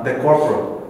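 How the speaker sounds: ordinary talk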